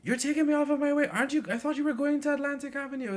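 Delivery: put-on high, loud indignant old lady voice; complaining old lady. Bus passenger scolding the driver, high indignant old lady. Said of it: "the ladies in there, they're like".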